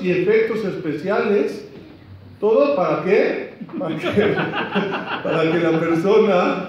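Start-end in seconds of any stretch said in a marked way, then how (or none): 3.8-6.7 laughing through the words; amused real laughter. Real, not acted. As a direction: let the laugh carry the words. Laughs while explaining.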